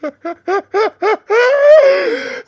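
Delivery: high, wheezing burst of laughter; shrieking laugh. Real, not acted. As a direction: lose it completely laughing. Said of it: "I'm crying tears, bro".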